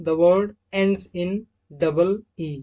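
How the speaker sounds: the word has read very slowly and deliberately; very slow dictated rule. Shown as a demonstration. Teacher reading a rule very slowly for students to note down. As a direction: drag out each word of the rule. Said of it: "rule number third".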